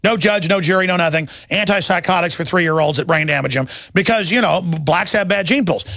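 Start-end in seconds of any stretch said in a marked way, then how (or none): none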